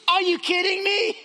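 high, loud disbelief; acted outraged astonishment; incredulous. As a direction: blurt it out, aghast, voice way up. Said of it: "put ourselves in Gehazi's shoes".

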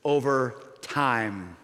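"over time" slow, low and drawn out; stress on over time. Shown as a demonstration. Preacher naming the maddening words slowly. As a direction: drag out "over time" in frustration. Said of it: "two words... that madden me".

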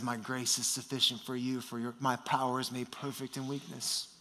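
Jesus' gentle voice. Acted. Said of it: "And Jesus says".

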